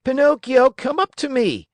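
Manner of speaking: high, loud puppet call; Harlequin, shouting. A narrator's reading. Puppet calls Pinocchio up to the stage, shouting. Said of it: "shouted Harlequin".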